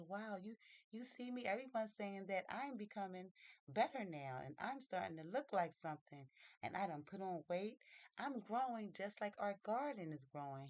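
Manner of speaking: lively, swooping pitch with punched words; excited. Acted. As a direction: marvel proudly at how you've grown. Mary amazed at her own growth.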